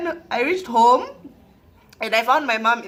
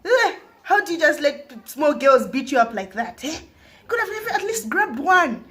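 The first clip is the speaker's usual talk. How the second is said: imitated scolding mum, rising higher and louder; mock maternal tirade; mother shouting; 6 semitones higher, 3 dB louder than usual. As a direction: tell off your child, building to an order. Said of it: "Start shouting at me".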